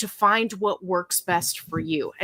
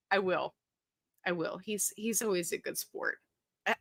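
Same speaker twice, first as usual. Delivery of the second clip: usual speaking voice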